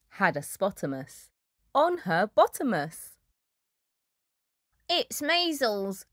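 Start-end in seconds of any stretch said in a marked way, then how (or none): 4.9-6.1 slow, higher, put-on weasel voice; a fussy weasel making a diagnosis. A narrator's reading. Weasel diagnoses the hippo, slow and higher-pitched. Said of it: "said Weasel".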